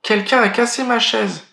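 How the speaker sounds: a small cub's wail over his soup; little bear crying out. Acted. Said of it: "crie le petit ours de sa petite voix".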